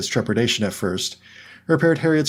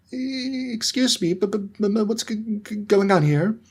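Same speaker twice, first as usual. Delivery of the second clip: much higher, nervous stuttering professor; 11 semitones higher, slower than usual. A narrator's reading. Professor Quirrell stuttering as he interrupts. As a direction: stumble over every word. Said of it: "came a stuttering voice".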